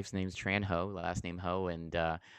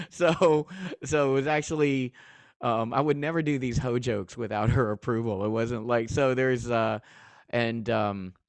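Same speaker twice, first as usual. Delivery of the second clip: talking through big real laughter, louder and higher; laughing loudly; 5 semitones higher, 10 dB louder than usual. Real, not acted. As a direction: crack up while trying to explain. Laughing about the ho jokes.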